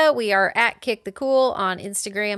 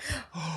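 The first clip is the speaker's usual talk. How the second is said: gasp; 15 dB quieter than usual. Real, not acted. Surprise at band album name.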